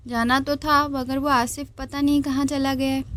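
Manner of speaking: ordinary delivery, nothing marked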